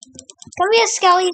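high-pitched coaxing call; calling a pet. Real, not acted. Player to Skelly the skeleton, coaxing call. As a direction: call it over, pitched right up.

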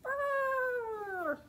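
high elephant trumpet call; mock trumpet. Librarian playing the elephant for children, trumpeting high.

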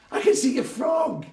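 put-on high, loud kid's shout; excited child's call. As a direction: call out like a thrilled boy. Childhood friend calls out.

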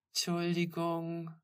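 slow, half-hearted apology; listless, drawn-out sorry; lame mumble. A narrator's reading. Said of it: "murmelte Tamara lahm".

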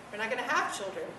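normal talking voice

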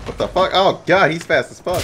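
high, loud shocked yell; startled shout. Real, not acted. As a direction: shriek at the fast enemy. Fast robot attacks.

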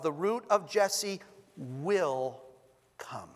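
slow down and lean into "will"; emphasis on will. Shown as a demonstration. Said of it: "I'm gonna put the emphasis on particular syllables".

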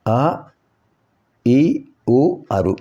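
teacher demonstrating "ఆ, ఈ, ఊ, అరు" as isolated letters; vowel pronunciation demo. Shown as a demonstration. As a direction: recite "ఆ, ఈ, ఊ, అరు" as separate rising vowels. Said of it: "ఒక పదాన్ని ఎలా ఉచ్చరించాలి".

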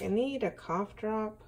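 ordinary talk

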